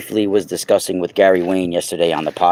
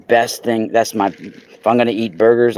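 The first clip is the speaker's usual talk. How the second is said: normal talking voice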